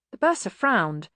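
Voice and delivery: usual speaking voice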